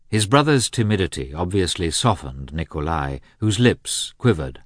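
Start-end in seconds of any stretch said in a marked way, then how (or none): none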